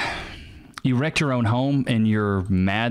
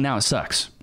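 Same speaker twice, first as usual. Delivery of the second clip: normal talking voice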